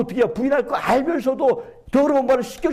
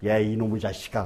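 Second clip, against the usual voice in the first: voicing the Lord; 13 semitones lower, 5 dB quieter than usual. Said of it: "주님께서 뭐라고 하신 줄 아세요".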